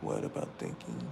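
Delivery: barely audible, unvoiced whisper. Real, not acted. Asking himself quietly after the rant, whispered. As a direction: mutter it under your breath.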